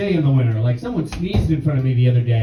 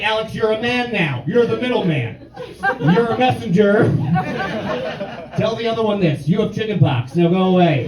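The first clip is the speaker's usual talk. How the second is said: parents voice; 6 semitones higher than usual. Retold or imitated.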